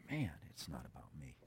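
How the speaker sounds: barely voiced, awed whisper; humbled whisper. Preacher declaring it is about Jesus, humbled whisper. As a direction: whisper it as a private realization.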